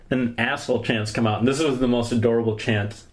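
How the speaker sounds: ordinary talk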